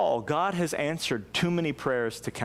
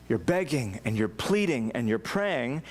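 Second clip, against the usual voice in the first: normal talking voice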